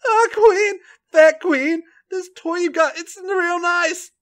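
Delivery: high, breathy Irish character voice; Becky's voice. A narrator's reading. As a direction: moan the words out, drawn out. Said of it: "Becky moans".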